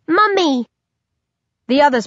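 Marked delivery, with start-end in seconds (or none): 0.0-0.7 high squeaky infant cry of Mummy; high, eerie baby voice; high-pitched baby voice. A narrator's reading. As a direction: call out like a tiny baby. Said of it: "in its high-pitched voice".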